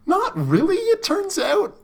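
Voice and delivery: very high, slow, teasing answer; high coy voice. Real, not acted. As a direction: sing it coyly.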